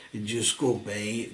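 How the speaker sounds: normal talking voice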